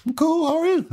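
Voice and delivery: high, breezy cheerful reply; mock chipper. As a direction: play it innocent and overly friendly. Retold reply to interrogator.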